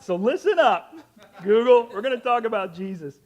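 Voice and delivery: loud, high mock command. Real, not acted. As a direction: boss the gadget around. Commanding the assistant.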